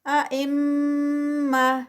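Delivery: ائمہ with ghunna and the ending stopped on ہ; tajweed recitation. Shown as a demonstration. Teacher models ائمہ for students. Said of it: "میم کا غنہ".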